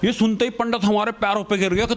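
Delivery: ordinary delivery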